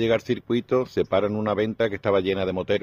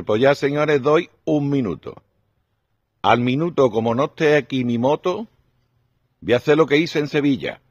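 peak mock threat; 7 semitones higher than usual. Biker's final warning to the bar, high hammed-up threat. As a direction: give them one last minute, hammed up.

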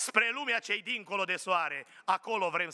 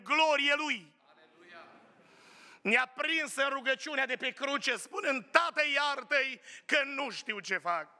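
full-force preaching; 7 semitones higher than usual. Acted. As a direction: lift the crowd with each phrase. (predică înflăcărată, mai sus și mai tare)

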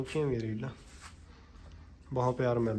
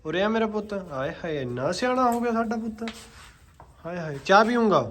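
dramatic loud aunty; 7 semitones higher, 4 dB louder than usual. Real, not acted. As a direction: coo at the horse like a fussing aunty. Doting on horse like aunty.

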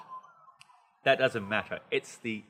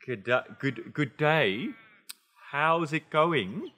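halting, over-careful learner's reading; without feeling; 4 semitones higher, much slower than usual. Shown as a demonstration. Teacher showing students how not to greet, stilted and hesitant. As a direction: sound out each word stiffly, unsure, slowly. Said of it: "So it's not".

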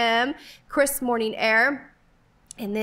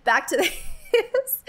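laughing; 7 semitones higher, slower than usual. Real, not acted. Laughing at own mistake.

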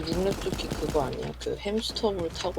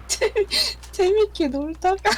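laugh-crying; 12 semitones higher, 9 dB louder than usual. Real, not acted. Crying-laugh after a near miss.

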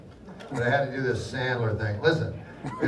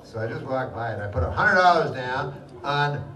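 ordinary delivery

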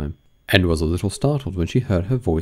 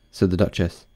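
ordinary delivery, nothing marked

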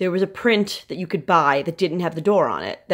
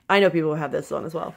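normal talking voice

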